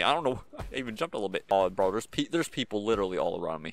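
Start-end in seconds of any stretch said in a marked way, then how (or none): none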